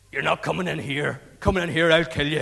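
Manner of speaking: lightly voiced hostile king; a touch of kingly threat; threatening king. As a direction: warn off intruders with acted menace.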